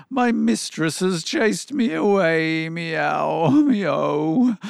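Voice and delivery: high cat complaint trailing into meows; wailing cat. A narrator's reading. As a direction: wail high, stretching out the miaows. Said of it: "the cat wailed".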